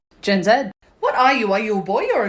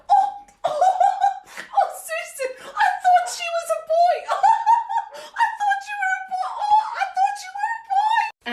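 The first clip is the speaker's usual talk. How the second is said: high cackling mockery; shrieking laughter. Boomer lady laughing at a shaved head, high. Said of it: "my absolute favorite".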